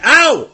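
sharp high ow; pained yelp. Acted. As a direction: yelp as if just hit. Being hit again, playfully.

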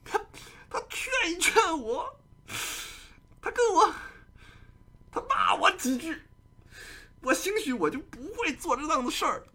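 high, crying regretful plea; hammed-up sobbing last words before execution; mock tearful plea. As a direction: wail it like a condemned man full of regret. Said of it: "你眼含热泪，你说".

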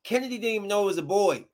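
ordinary talk, nothing marked

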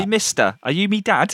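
a cheeky street kid from London, in a mock Cockney accent; parody British accent. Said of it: "parody-esque British voices".

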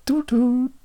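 high two-note chime; chime imitation. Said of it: "That was not it".

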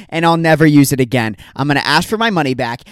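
ordinary talk

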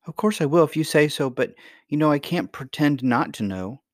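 put-on high, earnest child's protest; earnest young princess. Princess unable to pretend to grandmother, earnest.

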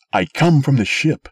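loud, high announcement; loud declaration. A narrator's reading. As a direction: call out over the crowd. Sim addressing the mob.

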